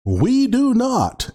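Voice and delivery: "We do not" slow and high, leaning on "not"; emphasize the word "not", drawing the phrase out; high-pitched, deliberate weight on "not"; stress on "not". Real, not acted. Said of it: "may I emphasize the word not".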